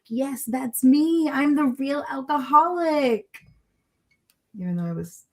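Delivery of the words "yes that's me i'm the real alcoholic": slow, high-pitched starry-eyed agreement; eager convert. Retold or imitated. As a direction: gush it like a true believer. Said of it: "I was like".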